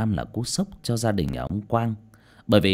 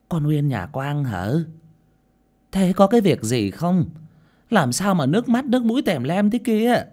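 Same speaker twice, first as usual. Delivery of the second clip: put-on higher-pitched elderly woman's questioning; puzzled old neighbour asking what's wrong; old woman's voice, pitched up, enquiring; old village woman, concerned; 9 semitones higher, 4 dB louder than usual. A narrator's reading.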